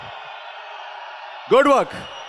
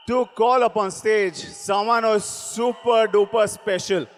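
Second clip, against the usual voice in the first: ordinary talk, nothing marked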